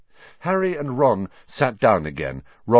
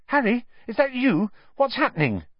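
anxious. A narrator's reading. Neville anxious in dark.

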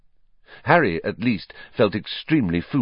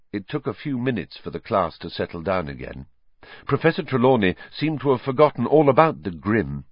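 ordinary delivery, nothing marked

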